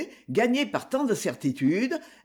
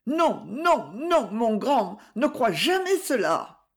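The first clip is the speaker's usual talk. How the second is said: pleading; 4 semitones higher than usual. A narrator's reading. Mother imploring her son, mildly pleading and slow. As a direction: beg him gently, drawing out the nons.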